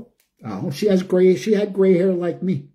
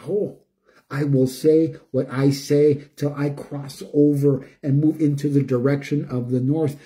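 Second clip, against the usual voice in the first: usual speaking voice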